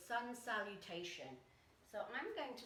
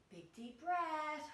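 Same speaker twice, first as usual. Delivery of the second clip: louder, higher, drawn-out words; big breath cue; 7 semitones higher, 6 dB louder, much slower than usual. Shown as a demonstration. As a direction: stretch it out loud like a big inhale. Closing breaths of sun salutation.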